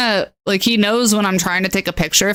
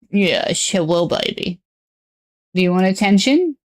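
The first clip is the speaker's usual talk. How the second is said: usual speaking voice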